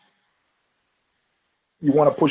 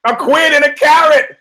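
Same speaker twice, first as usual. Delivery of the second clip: much higher, loud shout of the punchline; 12 semitones higher, 7 dB louder than usual. Real, not acted. Comic repeats his horse joke punchline, high shout. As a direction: yell it with glee. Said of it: "repeats own punchline".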